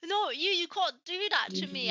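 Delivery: high, loud, pleading protest; mock hurt. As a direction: dramatise a wounded inner cry. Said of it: "I was just so hurt".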